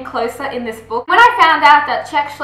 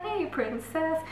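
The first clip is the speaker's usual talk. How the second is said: soft tender greeting; 13 dB quieter, slower than usual. Retold or imitated. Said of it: "he's like".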